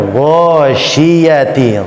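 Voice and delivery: GHASYIYATI opening on a heavy "gho"; slow ghasyiyati with a rounded first vowel; thick gha. Shown as a demonstration. Teacher drilling an example, thick Ghasyiyati. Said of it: "Gha tebal".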